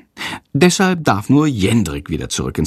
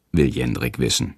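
plain reading voice

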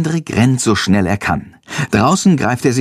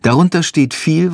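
plain reading voice

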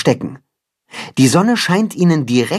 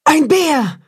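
high, startled shout of alarm; wolf mother shrieks in alarm at the bear; frightened cry; 13 semitones higher than usual. A narrator's reading. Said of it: "ruft die Wolfsmutter erschrocken".

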